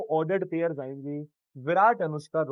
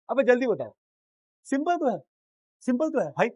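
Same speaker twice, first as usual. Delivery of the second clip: impatient scolding; 6 semitones higher, 3 dB louder than usual. Real, not acted.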